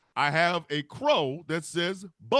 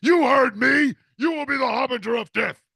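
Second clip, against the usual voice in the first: much louder, higher roar; booming divine shout; 7 semitones higher, 9 dB louder than usual. Pretend. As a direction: thunder it down at the crow. That was scary.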